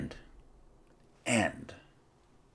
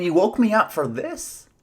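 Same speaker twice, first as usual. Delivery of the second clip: mock-exasperated, pitched up high; exasperated Jesus; 8 semitones higher, 4 dB louder than usual. Jesus woken by the disciples, mock exasperation. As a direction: lightly play an annoyed Jesus woken from his nap. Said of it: "It's like he's saying".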